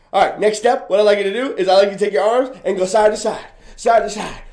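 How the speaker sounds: ordinary talk, nothing marked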